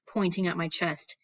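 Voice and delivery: usual speaking voice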